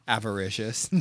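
Avaricious said with mock pride; savoring a big word. Shown as a demonstration. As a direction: relish each syllable of Avaricious. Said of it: "just 'cause I used a big word".

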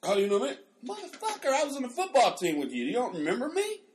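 high-pitched, hammed-up outrage at being forgotten; acted indignation. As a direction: retell it as an offended old teammate. Acting indignation at forgetful old classmate.

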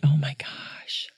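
embarrassed whisper after a slip. Real, not acted. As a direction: breathe it out in a whisper, caught in a mistake. Catching her own blooper.

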